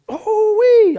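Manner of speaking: high, loud oh-wee; excited whoop. Retold or imitated. Newcomer fired up after church, loud high whoop.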